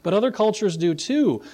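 normal talking voice

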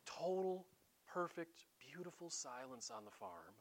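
hushed storybook narration. Acted. Preacher mocking an idyllic childhood storybook, hushed narration. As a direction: paint the perfect sunrise in a hushed voice.

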